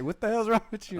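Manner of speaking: high-pitched mock outrage; imagined appalled boss. Co-host imagines serious boss thrown off, mock outrage.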